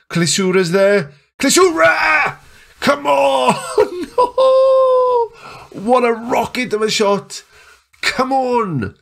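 very high, ecstatic screaming, easing to high cheers; wild, drawn-out celebratory yells; goal celebration. Real, not acted. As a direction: scream the goal home in real elation.

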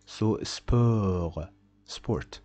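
sport with no final T, French style. Shown as a demonstration. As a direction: drop the T in sport. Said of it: "T is not pronounced at the end".

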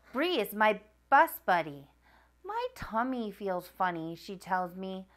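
lively picture-book read-aloud voice; reading to children. A narrator's reading. Narrator reading Fancy Nancy to young viewers, animated read-aloud. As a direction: read it like bedtime story time for kids.

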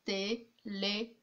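"Téléphone" slowly broken into té, lé, phone; a clear pause after each part of "Téléphone"; three separate sounds. Shown as a demonstration. Teacher showing three sounds heard, slowly syllable by syllable. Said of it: "on entend trois sons".

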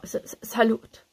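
halting stammer on the first sound; croaked, uncertain greeting. A narrator's reading. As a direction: stumble into the greeting, unsure. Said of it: "krächzte ich und hasste, dass man mir die Unsicherheit anhörte".

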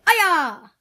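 loud high kiai; karate shout. Shown as a demonstration. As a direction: yell it like a strike. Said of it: "you say "Aya!".

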